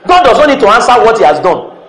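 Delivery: ordinary delivery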